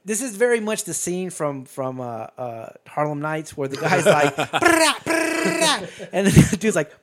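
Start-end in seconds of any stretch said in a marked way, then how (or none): 4.4-6.1 high, loud rolled burst of gun noise; mock machine-gun blasts; imitated gunfire. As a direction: trill out rapid fire with the mouth. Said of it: "Charlie's like unloading this rifle".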